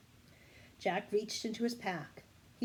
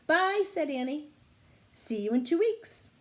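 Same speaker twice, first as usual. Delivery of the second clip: cheerful goodbye; 8 semitones higher, 6 dB louder than usual. Acted. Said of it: "Annie calls goodbye".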